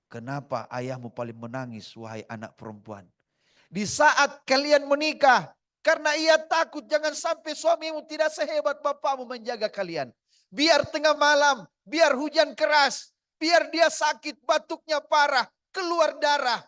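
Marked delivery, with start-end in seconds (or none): 3.7-5.5 impassioned preaching. Acted. Peak of sermon on fathers. 5.8-9.7 high, loud pulpit delivery; loud preaching. Acted. Repeated line, preached loud. 9.7-16.6 impassioned preaching. Acted. Peak of sermon on fathers.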